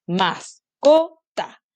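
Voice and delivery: mascota chopped slowly into syllables; slow, evenly split syllables in "mascota". Shown as a demonstration. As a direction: say "mascota" as separate beats, MAS-CO-TA. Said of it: "La separo así".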